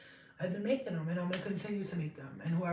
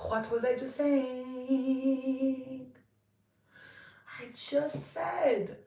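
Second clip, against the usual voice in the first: drawn-out indignation; 5 semitones higher, much slower than usual. Acted.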